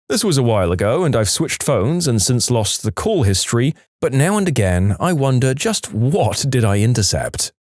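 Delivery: ordinary delivery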